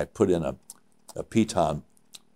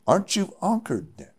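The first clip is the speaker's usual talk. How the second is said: high-pitched mock Swiss accent; Swiss-accented question, pitched well up; 11 semitones higher than usual. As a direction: imitate a Swiss climber questioning his partner. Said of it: "he has his Swiss accent".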